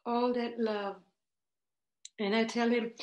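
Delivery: normal talking voice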